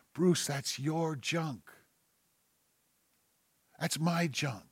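soft, slow, weighty answer; hushed confession. Acted. Preacher owning his sin before the congregation, hushed and slow. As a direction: let it land quietly and slowly. Voicing the answer that comes back.

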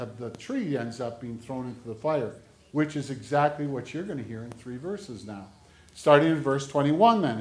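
ordinary delivery

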